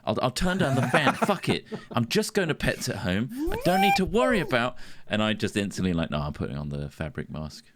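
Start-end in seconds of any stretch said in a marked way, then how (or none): 1.1-4.8 hyped-up bravado. Retold or imitated. Retold hyped-up self, laughing.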